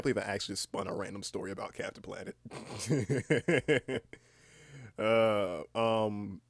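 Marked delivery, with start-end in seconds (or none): 2.4-4.1 a run of rising giggles; amused chuckle. Real, not acted. Laughing at own random story, real giggle. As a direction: giggle helplessly at yourself.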